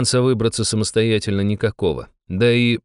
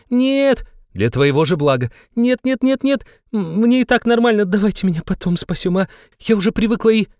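much higher, panicky squealing refusals; panicked pleading; 12 semitones higher than usual. Acted. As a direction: beg them frantically to stop. Shaman panicking before being cut.

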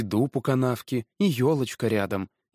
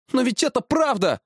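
put-on high, insistent boyish voice; protesting boy; 13 semitones higher than usual. A narrator's reading. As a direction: jump in to defend the truth. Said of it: "вступился мальчик". (протестующий мальчик, высоко)

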